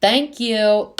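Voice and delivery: high, loud, drawn thanks; emphatic agreement. Real, not acted. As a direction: exclaim it in total vindication. Emphatic agreement with quoted tweet.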